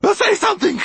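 very high, loud screech; Magpie's shout. A narrator's reading. Mortola demanding an answer from silent Basta, shrieked order. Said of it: "shouted the magpie".

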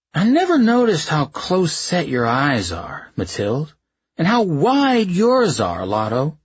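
put-on slow, slurred drunken words; slurring drunk. A narrator's reading. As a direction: slur it out like you've had too many. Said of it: "slurringly drunk".

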